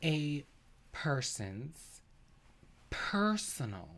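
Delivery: hushed, patronizing word-by-word delivery; deliberately slow. Acted. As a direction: say it slowly and softly, pausing after each word. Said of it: "I'm gonna say it slowly".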